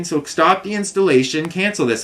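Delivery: high firm insistence; firm demand. Retold or imitated. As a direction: insist they cancel now. Demanding cancellation.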